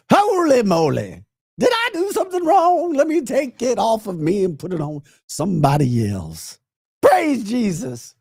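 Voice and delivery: high mock voice reading a chat comment. Reading a viewer's mocking comment aloud in a high mock voice. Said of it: "as you just heard the comment".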